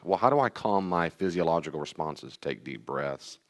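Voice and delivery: ordinary delivery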